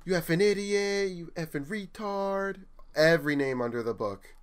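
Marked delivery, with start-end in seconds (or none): 0.0-2.6 much higher voice spitting insults; mock insulting yell. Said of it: "starts calling me".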